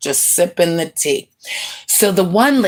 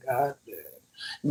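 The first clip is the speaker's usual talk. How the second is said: hushed disbelief; 8 semitones lower, 14 dB quieter than usual. Retold or imitated. Stunned reaction to her story.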